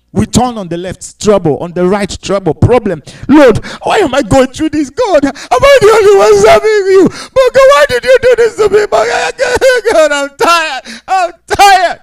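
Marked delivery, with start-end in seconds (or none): 3.3-12.0 high, loud, crying plea of a weary believer; preacher voicing a sufferer's tearful complaint; anguished cry to God. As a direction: wail it out to God like you're at the end. Sufferer's cry voiced in sermon.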